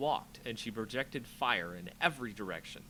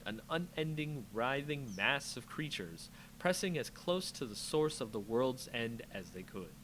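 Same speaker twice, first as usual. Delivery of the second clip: ordinary delivery, nothing marked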